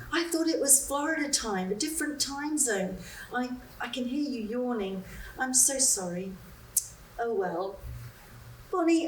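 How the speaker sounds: a woman forcing cheer while telling her friend of her loss; Betsy on the phone. Acted. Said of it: "reading the part of Betsy".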